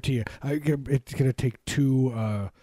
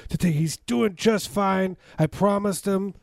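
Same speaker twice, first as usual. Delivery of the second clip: mock pleading Biden; 7 semitones higher than usual. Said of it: "He's like".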